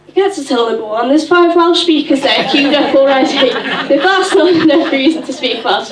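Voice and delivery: real laughter at her own Aldi story; laughing. Real, not acted. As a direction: crack up as you land the joke. Punchline of funny Aldi story.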